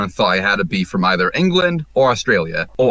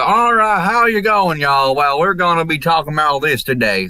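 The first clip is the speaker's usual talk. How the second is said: exaggerated Southern accent; 6 semitones higher than usual. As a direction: overdo a Tennessee twang. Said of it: "mine was more the".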